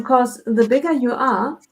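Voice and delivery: ordinary delivery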